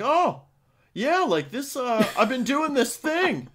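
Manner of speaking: high, chirpy rambling customer voice; chatty customer. Retold or imitated. Mimicking a chatty customer at the register, rambling. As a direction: mimic the oblivious chatty shopper. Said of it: "they'll just be like".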